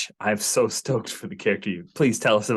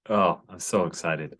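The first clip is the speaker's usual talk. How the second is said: usual speaking voice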